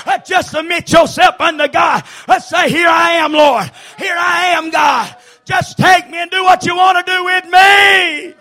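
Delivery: high, loud whooping preacher cadence; fiery revival preaching; preaching at full force. Acted. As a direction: shout it out, driving each line home. Preaching climbs again to shouting.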